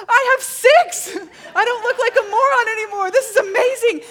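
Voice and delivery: high, shouted, near-tearful elation; overjoyed outburst. Retold or imitated. Retelling three new syllables at camp, overjoyed shouting. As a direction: burst out in hammed-up amazement.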